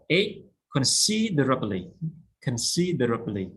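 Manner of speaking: considerably with the second syllable stressed; stress on SID. Shown as a demonstration. Teacher drilling the class, stress on sid. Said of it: "stress on C".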